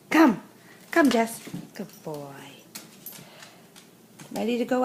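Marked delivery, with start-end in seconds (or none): 0.1-1.5 loud sharp call; calling a dog. Real, not acted. 1.7-2.3 soft, low praise; praising a dog. Real, not acted.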